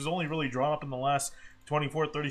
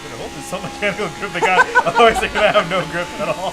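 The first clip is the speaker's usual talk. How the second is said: laughing; 10 dB louder than usual. Real, not acted. Laughing at co-host's voice.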